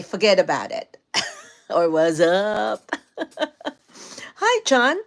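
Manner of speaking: ordinary delivery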